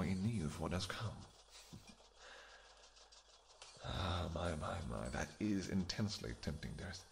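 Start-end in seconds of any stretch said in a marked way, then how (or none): 4.3-7.0 low seductive murmur. Acted. Aroused, low to his lover.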